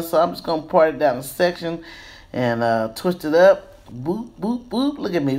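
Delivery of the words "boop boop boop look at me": ordinary talk, nothing marked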